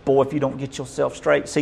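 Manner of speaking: usual speaking voice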